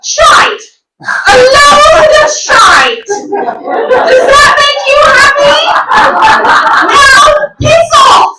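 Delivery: high, loud, slow British mum ranting; drawn-out high British shouting; angry British mum. Retold or imitated. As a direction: yell like a furious British mother. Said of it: "thick accent".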